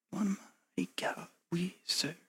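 breathy whisper, almost no voice; whispered song line. Shown as a demonstration. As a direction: hush the old song to yourself. Recalling a church song softly.

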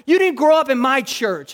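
high, loud mock-angry retort; heated, aggrieved comeback, retold; imitated anger. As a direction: act out an angry ex-churchgoer. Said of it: "just so angry at church".

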